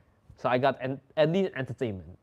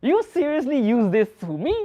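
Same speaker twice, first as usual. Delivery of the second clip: louder and higher, mock outrage; incredulous; 8 semitones higher, 6 dB louder than usual. Answering the girls' ni hao, in mock outrage. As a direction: playfully call out the cheesy line. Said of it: "I'm like".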